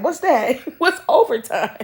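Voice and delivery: baffled dad quoted through laughter; mock confusion cracking up mid-quote; laughing. Dad hearing of overtime, said laughing.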